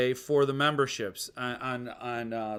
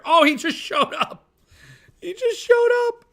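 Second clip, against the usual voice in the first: excited; 7 dB louder than usual. Real, not acted. Streamer greets a late arrival.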